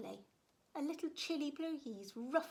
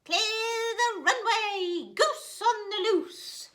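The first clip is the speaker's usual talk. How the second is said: high, loud and slow shout; 11 semitones higher, 11 dB louder, much slower than usual. Acted. Gooseberry racing along the bank, slow high shout. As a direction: bellow it like a pilot on takeoff. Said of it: "he shouted".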